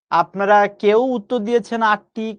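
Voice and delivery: usual speaking voice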